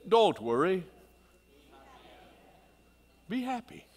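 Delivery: Jamaican impression. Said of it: "That was my best Jamaican impersonation".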